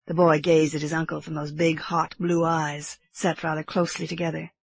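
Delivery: plain reading voice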